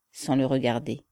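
ordinary delivery

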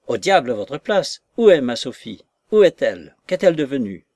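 frantic demand. Acted. Lover demanding news of Sophie from her maid, frantic and impatient. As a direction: fire off urgent questions.

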